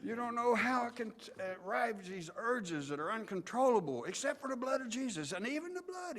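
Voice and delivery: high, impassioned sermon; passionate preacher. Retold or imitated. Preacher warning of uncontrollable urges, high and passionate. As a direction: let the fervor climb phrase by phrase. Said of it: "he was getting passionate about it".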